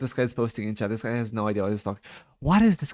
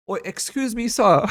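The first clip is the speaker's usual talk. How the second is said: higher-pitched attempted British accent; 10 semitones higher, 3 dB louder, slower than usual. Shown as a demonstration. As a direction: put on a rough British accent. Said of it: "can you do a British one".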